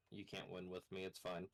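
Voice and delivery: normal talking voice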